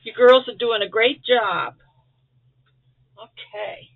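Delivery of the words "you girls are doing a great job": loud, beaming praise; enthusiastic praise. Real, not acted. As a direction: cheer them on warmly. Praising the senders loudly.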